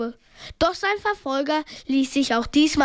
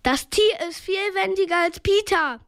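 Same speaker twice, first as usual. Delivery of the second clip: higher-pitched call; raised, projected pitch; 5 semitones higher than usual. A narrator's reading. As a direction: call across to a friend. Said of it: "rief Bob Justus zu".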